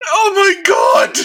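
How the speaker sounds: high squealing laugh through words; laughing shriek. Real, not acted. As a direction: crack up mid-shout. Bursting out laughing at joke.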